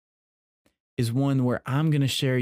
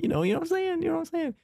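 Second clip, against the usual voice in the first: ordinary talk, nothing marked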